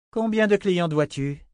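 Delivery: high, loud, chilling demand; bloodcurdling question. Acted. The sister questions the frightened girl, chilling and high. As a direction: make the child's blood run cold. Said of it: "d'un ton à glacer les sangs".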